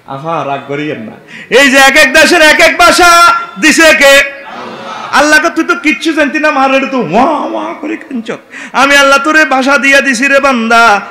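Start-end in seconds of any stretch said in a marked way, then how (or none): 1.5-11.0 loud, high impassioned sermon; preaching at full force. Acted. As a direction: thunder it to the audience. Preaching, voicing God's words.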